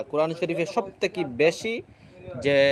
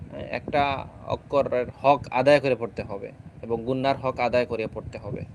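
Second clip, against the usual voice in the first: usual speaking voice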